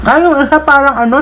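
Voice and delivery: usual speaking voice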